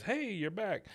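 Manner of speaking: high-pitched imitated hello; Bill greeting. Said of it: "and Bill says".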